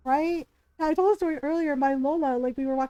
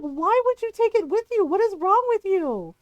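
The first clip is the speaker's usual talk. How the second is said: exasperated; 6 semitones higher, 4 dB louder than usual. Retold or imitated. Exasperated at the game character.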